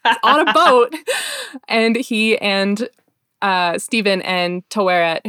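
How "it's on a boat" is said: high, loud triumphant cry; excited cry. Real, not acted.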